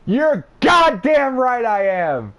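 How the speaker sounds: high, loud, slow yell; screamed reply. Retold or imitated. He yells back at Justin about the podcast, high and loud. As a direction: belt it out proudly. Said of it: "I fucking screamed out".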